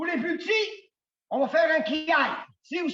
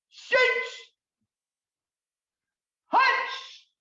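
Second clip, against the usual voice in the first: sharp, high counting cries; raised-pitch martial-arts count; high, ringing count; 8 semitones higher, 4 dB louder, much slower than usual. Shown as a demonstration. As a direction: count each strike well above the normal speaking voice.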